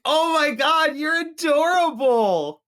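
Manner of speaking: hammed-up doting mother cooing; gushing mom. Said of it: "And she's like".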